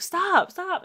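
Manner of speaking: high-pitched imitation of begging; alarmed plea. Retells Whisper pleading at gunpoint.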